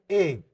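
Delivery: ए as long "eh"; emphatic ए, pure "eh"; correct German E. Shown as a demonstration. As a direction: repeat ए firmly as a model. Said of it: "इसको बोलेंगे ए".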